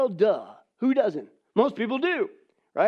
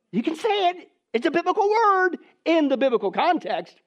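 normal talking voice

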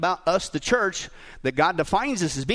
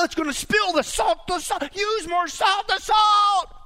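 high, urgent backseat-driver nagging; acted-out husband in a frantic, hammed-up panic; frantic yelling husband; 10 semitones higher, 3 dB louder than usual. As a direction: yell panicky orders in a rapid, high-pitched stream. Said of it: "he immediately starts yelling at her".

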